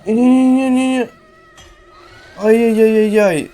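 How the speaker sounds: loud, high rapid no-no-no; panicked. Real, not acted. Player chased by Хагі, panicked loud cries. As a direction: panic as the monster closes in.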